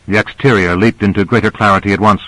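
usual speaking voice

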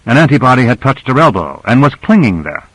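plain reading voice

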